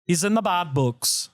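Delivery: light Irish accent on bad books; a touch of Irish brogue. Shown as a demonstration. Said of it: "which in Irish means the doghouse".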